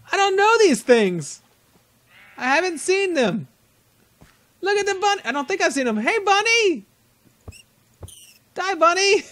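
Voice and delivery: high, excited game chatter; squealing with delight at the bunny. Real, not acted. Excited at new game animals.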